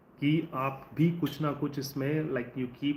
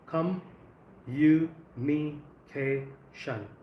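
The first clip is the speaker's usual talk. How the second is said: very slow "communication", weight on "ca"; teacher drills "communication" syllable by syllable; stress on the fourth syllable; much slower than usual. Shown as a demonstration. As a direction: stretch out "communication", hitting "ca" before "tion". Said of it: "stress on the fourth".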